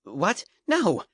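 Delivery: sharp high cry of alarm; alarmed exclamation. A narrator's reading. Boy refuses to hand over crystals, alarmed cry. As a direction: blurt out a shocked refusal. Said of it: "Dort exclaimed".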